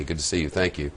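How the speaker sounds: normal talking voice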